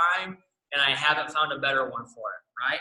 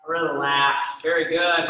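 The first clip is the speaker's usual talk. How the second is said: talking with the head tilted to the side; neck tilted; 5 dB louder, much slower than usual. Real, not acted. Instructor counting down mid neck stretch, voice strained by the tilt. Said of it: "My voice is a little bit different there too".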